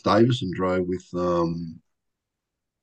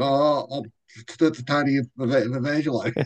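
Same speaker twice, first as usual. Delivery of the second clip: slow, higher, stammering over his own name; nervous stammer; 5 semitones higher than usual. Retold or imitated. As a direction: trip over your name like a nervous rookie. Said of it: "I said".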